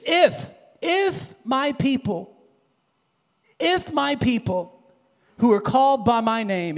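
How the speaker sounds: reverent scripture reading; scripture recitation. Acted. Quotes favourite scripture solemnly.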